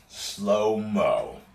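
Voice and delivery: normal talking voice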